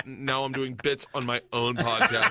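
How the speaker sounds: ordinary delivery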